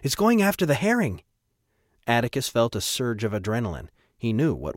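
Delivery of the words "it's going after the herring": much higher, tense alert; tight with tension. A narrator's reading. Captain warns it's going after the herring, tense. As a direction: raise the alarm with a tight voice. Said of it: "his voice tight with tension".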